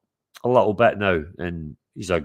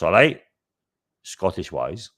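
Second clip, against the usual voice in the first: ordinary talk, nothing marked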